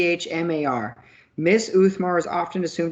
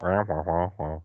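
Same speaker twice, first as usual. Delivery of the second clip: Charlie Brown teacher; 11 semitones lower, 5 dB quieter than usual. Pretend. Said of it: "teaching Charlie Brown?".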